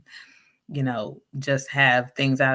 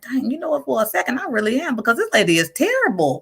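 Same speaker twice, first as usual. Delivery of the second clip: worked-up realization; 11 semitones higher, 4 dB louder than usual. Retold or imitated. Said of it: "I was like".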